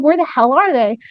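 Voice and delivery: ordinary talk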